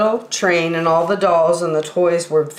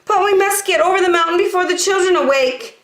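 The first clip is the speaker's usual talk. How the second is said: high and fast pleading; urgent toys; 13 semitones higher, faster than usual. Acted. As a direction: hurry the engine along. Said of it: "said all the dolls and the toys".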